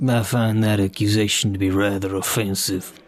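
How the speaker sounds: put-on mumbled, low-pitched southern accent; barely intelligible bad southern accent; terrible southern drawl. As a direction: slur it in a badly faked southern drawl. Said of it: "your southern accent is friggin' terrible".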